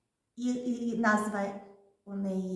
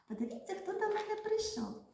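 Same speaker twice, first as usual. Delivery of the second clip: playful surprise; 11 semitones higher, 5 dB quieter, faster than usual. Acted. Teacher introduces a puppet guest.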